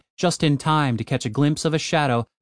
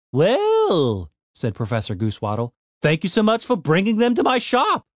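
sarcastic gnome; 6 semitones higher than usual. Acted. Annoyed professor.

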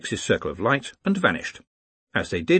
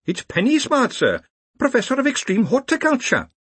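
very high-pitched academic voice; high, dutiful subordinate; prim, eager professor; 4 dB louder than usual. Acted. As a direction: report to the chief in a high, proper voice. Penny Smart introduces self.

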